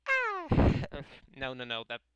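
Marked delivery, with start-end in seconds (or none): 0.1-0.5 short, very high yelp; loud squeal of embarrassment; cringing yelp. Real, not acted. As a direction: yelp at your own cringy acting. Cringes at own voice acting.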